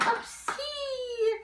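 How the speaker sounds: high squeal of discovery; mock surprise. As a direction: act delighted to find it. Teacher mock finding a letter.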